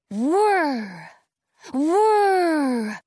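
loud whirring machine noise; blender whir. A narrator's reading. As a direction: make a loud buzzing blender sound. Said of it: "It sounds kind of like a blender".

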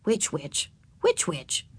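soft, low inward repetition; quiet musing on a tongue-twister; savouring the words. A narrator's reading. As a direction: murmur it to yourself with relish. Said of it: "liking the sound of the words".

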